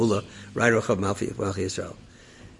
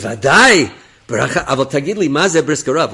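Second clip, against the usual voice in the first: loud, higher officer speaking Hebrew; Israeli officer; 4 semitones higher, 10 dB louder than usual. Retold or imitated. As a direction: voice the brash soldier asking. Israeli officer voiced in story.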